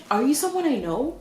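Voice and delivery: high, loud startled question. Retold or imitated. Telling viewers her first thought at the catfish's message, startled. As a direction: blurt it as a retold shock.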